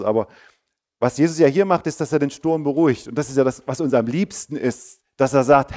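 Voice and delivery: ordinary talk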